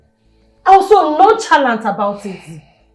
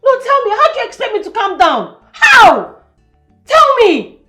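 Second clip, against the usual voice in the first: high, agitated challenge; worked-up, pleading outburst; exasperated demand; 6 semitones higher than usual. Acted. Daughter challenges mother's calming.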